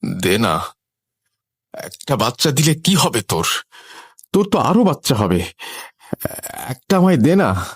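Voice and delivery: tearful begging. A narrator's reading. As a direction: beg brokenly, as if crying, with long gaps. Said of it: "সে এবার কাঁদতে কাঁদতে বলে". (কাঁদতে কাঁদতে কাতর মিনতি)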